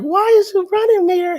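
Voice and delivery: put-on high, loud shout after the boy; calling out. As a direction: yell after him as he runs off. Calling after fleeing grandson.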